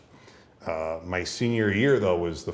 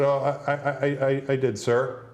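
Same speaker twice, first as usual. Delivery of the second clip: mock stammering teen, higher voice; stammered reply; 7 semitones higher than usual. Teenager answering an older man at the gym, stammered reply. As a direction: stumble over the first word, politely deferential.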